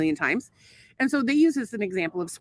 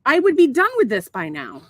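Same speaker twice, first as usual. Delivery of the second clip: annoyed; 2 semitones higher, 4 dB louder than usual. Real, not acted. Annoyed at co-host interrupting.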